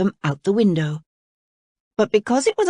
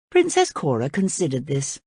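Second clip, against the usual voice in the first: plain reading voice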